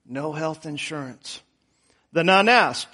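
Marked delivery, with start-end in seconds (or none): 0.1-1.4 faint, hoarse and much softer; weak raspy voice. A narrator's reading. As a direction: barely get the words out, rasping. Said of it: "in a weak, raspy voice".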